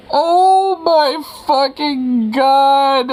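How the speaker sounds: high, slow drawn-out shriek; shrieking jackpot winner. Real, not acted. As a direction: stretch it out in a shriek. Overwhelmed by trailer.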